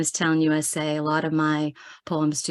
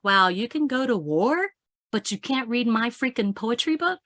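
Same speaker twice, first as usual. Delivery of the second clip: higher-pitched mock indignation; shocked disbelief; 6 semitones higher than usual. Poet protesting the age rating on her book, incredulous. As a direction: retell your outrage as an incredulous question. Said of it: "I was shocked".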